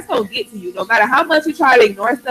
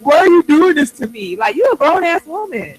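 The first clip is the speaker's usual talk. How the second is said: high, louder imitation of whining; mock whiny baby; 7 semitones higher, 5 dB louder than usual. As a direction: whine like a baby, mocking the complaint. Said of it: "she sounded like a baby".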